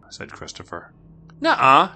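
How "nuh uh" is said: put-on louder, higher kid protest; shouted denial. Girl contradicts her brother to the guard, loud shouted denial. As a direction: blurt out a contradiction. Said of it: "shouted Marie".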